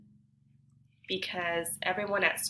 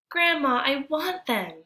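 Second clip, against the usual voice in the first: put-on pleading boy; 7 semitones higher, 6 dB louder than usual.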